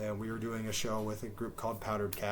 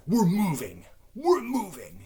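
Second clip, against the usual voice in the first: high, louder imitation of an irate man; mock indignation; 7 semitones higher, 6 dB louder, slower than usual. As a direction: mimic a huffy complainer's announcement. Mimics angry man announcing move.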